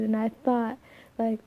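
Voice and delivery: normal talking voice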